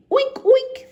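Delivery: high, loud oinking; pig oink. A narrator's reading. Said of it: "the pig".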